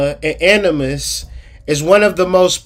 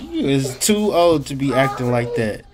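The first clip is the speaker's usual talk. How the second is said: low mock-scolding parent voice; stern parent; 6 semitones lower, 6 dB quieter than usual. Real, not acted. Scolding the kid.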